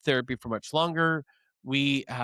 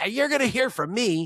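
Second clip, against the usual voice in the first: angry threat; 8 semitones higher than usual. Retold or imitated. Husband storming home threatening his wife, mock angry. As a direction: storm in and threaten her, half-acted.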